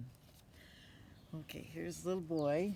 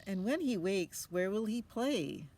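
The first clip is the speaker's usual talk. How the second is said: higher, slow questioning mother; mother asking; 4 semitones higher, 7 dB louder, slower than usual. Acted. Mother questioning her boy, higher and slow. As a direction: ask it like a careful mom. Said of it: "This is the mother asking".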